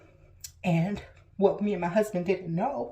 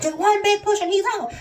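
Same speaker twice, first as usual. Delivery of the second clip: higher, louder coaching call; urging push; 12 semitones higher, 9 dB louder than usual. Retold or imitated. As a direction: push her over the line. Nurse urging final push.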